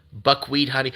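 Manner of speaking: ordinary talk, nothing marked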